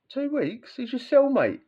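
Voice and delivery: high, incredulous mock outrage; mock disbelief. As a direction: pitch it way up in disbelief at the cellmate. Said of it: "I'd be like".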